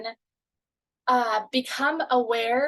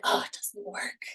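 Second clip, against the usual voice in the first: mock defeated whisper; 3 semitones lower, 7 dB quieter than usual. Mock disappointed self quote.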